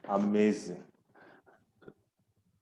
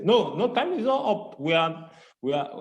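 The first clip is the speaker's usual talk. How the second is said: high, eager no, no; excited protest; 6 semitones higher than usual. Real, not acted. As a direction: jump in, protesting playfully. Host playfully protests time's up.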